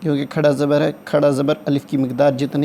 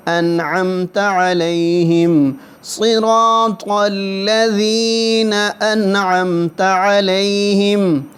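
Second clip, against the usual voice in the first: slightly raised, steady chanting of the verses; Quranic recitation; 5 semitones higher, much slower than usual. Shown as a demonstration. Teacher reciting Fatiha line by line for students, chanting. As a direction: recite each phrase slowly and repeat it.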